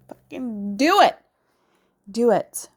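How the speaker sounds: firm loud encouragement; emphatic urging. Real, not acted. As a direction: push them to let it out. Urging viewers to release.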